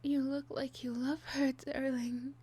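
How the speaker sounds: high, loud, tearful breakdown; sobbing, begging, voice breaking high; unhinged villain. Acted.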